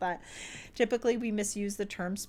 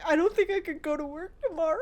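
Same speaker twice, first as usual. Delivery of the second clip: mock sobbing; 9 semitones higher, 4 dB louder than usual. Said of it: "crying and bawling my eyes out".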